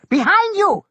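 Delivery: very high loud scream. A narrator's reading. Kimmy screaming at Tuck in the water, very high loud scream. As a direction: scream the order at him. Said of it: "Kimmy screamed".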